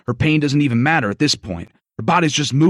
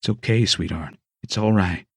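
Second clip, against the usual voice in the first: gentle coaxing; 10 semitones lower than usual. Acted. Doc coaxing Mia in a low gentle voice. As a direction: coax her calmly. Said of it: "he coaxed".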